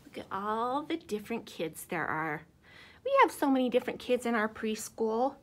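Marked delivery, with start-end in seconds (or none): 3.0-5.3 put-on reading to children. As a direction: read it out big for the little ones. Reading book to kids.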